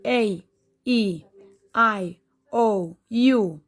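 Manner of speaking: long vowels "A, E, I, O, U" said as their letter names; long vowel sounds. Shown as a demonstration. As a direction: say each vowel's name, drawn out for the class. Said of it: "they sound like this".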